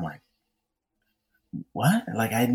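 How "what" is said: high, incredulous question; stunned disbelief. Retold or imitated. Asked out by the intimidating actress, stunned. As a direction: react as if caught totally off guard, pitch jumping. Stunned by unexpected date invitation.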